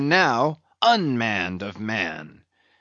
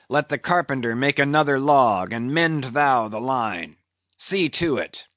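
plain reading voice

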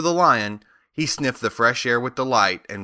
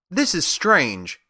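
exclaiming; 7 semitones higher, slower than usual. A narrator's reading. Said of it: "exclaimed Dorothy".

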